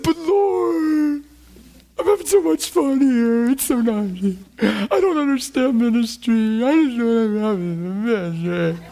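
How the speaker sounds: high, whiny, hammed-up blubbering; comic sobbing, words stretched into a wail; mock crying. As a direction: wail a protest like a bawling child. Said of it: "just start crying".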